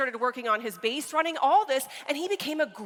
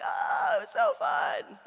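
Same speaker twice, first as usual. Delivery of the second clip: put-on much higher, thrilled kid's squeal of joy; excited kid; 12 semitones higher, slower than usual. Son telling mom about the catch, squealing high with joy. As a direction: gush like a kid who just made the catch.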